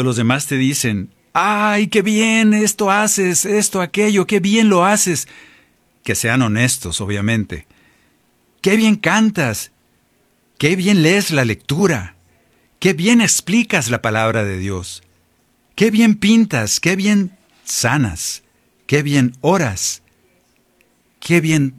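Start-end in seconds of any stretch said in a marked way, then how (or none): 1.3-5.3 high, slow, sugary admiring voice; mock-gushing praise, pitched well up. Mimicking people praising you. 8.6-21.7 high, slow, sugary admiring voice; mock-gushing praise, pitched well up. Mimicking people praising you.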